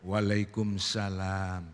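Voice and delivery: slow, low drawn-out reply; Burhan's greeting. Acted. Burhan answering the visitor, slow low greeting.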